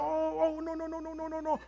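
hushed, dreading oh no no no as the car slides; mock panicked no. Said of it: "you're going".

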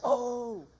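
high, drawn-out "oh" in imitation; Pastor George. Preacher imitating a fellow pastor's habit, high and drawn-out. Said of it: "Pastor George does this a lot".

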